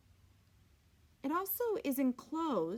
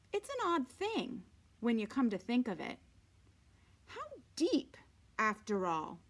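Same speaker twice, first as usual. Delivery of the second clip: even, unhurried read-aloud voice; story narration; 5 dB louder than usual. A narrator's reading. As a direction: read the novel steadily to the class. Teacher reads novel aloud to class.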